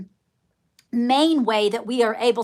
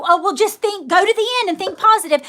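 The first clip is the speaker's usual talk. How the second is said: mocking the gurus; 8 semitones higher, 5 dB louder, faster than usual. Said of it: "and then I'm telling you".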